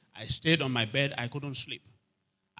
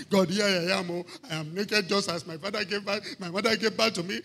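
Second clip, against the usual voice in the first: mock prayer; 4 semitones higher, 4 dB louder than usual. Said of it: "And I go naked at midnight".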